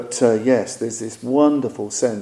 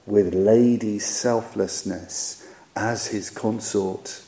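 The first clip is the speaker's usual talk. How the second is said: measured, solemn recitation of a sacred song; poem reading; 5 dB louder than usual. Acted. Teacher reciting the song to his listeners, solemn reading. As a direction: read it like a devotional verse. Reads a tantric song aloud.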